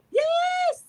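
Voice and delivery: a high, ringing exclamation; emphatic yes. Real, not acted. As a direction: nail it home with a high shout of agreement. Excited affirmation to audience.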